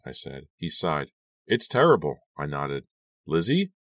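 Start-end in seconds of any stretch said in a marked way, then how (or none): 1.5-2.2 dismayed. A narrator's reading. Dad responding to missing girl. 3.2-3.7 concerned call. A narrator's reading. Dad calling her name.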